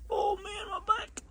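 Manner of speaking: strained groan. Real, not acted. Strained while bending.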